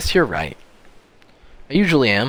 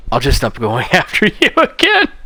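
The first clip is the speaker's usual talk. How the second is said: louder, breaking into laughter; laughing line delivery; 3 semitones higher, 8 dB louder than usual. Acted. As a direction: act it out loudly, laughing through it. Acting out remembered game line.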